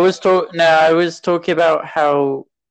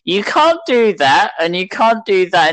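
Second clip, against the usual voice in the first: higher, slow, drawn-out mock telling-off; scolding Jedi Masters; 5 semitones higher than usual. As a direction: imitate elders scolding a child. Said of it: "They're like".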